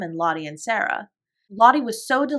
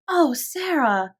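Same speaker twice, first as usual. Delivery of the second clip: put-on high, slow little girl's cry; Lottie crying out; 7 semitones higher, much slower than usual. A narrator's reading. Lottie cries out to Sarah, high and slow. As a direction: stretch the oh like an excited small child. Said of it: "cried Lottie".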